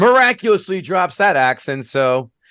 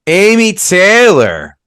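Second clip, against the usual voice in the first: a louder, slow-stretched name call; drawn-out host call; 7 semitones higher, 7 dB louder, much slower than usual. Real, not acted. Host calling on Amy Taylor to speak, loud and drawn out. Host calls on next panelist.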